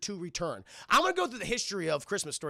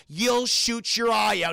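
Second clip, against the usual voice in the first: loud, drawn-out pronouncement; mock-ominous slow verdict; taunting verdict; 4 semitones higher, 8 dB louder, slower than usual. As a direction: deliver the bad news slowly and loudly. Teacher's note voiced as a taunt.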